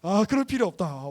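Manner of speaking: loud, insistent protest as Peter. Acted. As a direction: push back hard, why why why.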